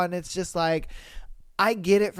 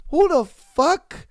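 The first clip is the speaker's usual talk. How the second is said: outraged; 10 semitones higher, 6 dB louder, slower than usual. Real, not acted. Outraged rant at cheater.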